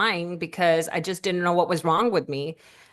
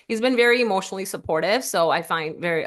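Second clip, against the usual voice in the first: normal talking voice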